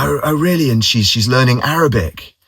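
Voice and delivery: colleague's delighted surprise; surprised. Retold or imitated. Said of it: "he was like".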